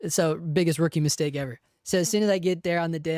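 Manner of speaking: ordinary talk, nothing marked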